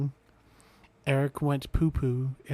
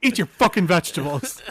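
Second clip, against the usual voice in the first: dad scolding; 3 semitones higher, 8 dB louder than usual. Acted.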